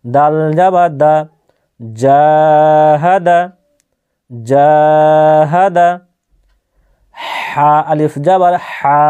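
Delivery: Arabic primer spelling chant, letter by letter; measured teacher's recitation, syllables joined into words. Shown as a demonstration. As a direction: spell quickly, then hold the long vowels. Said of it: "শব্দগুলো বানান করে".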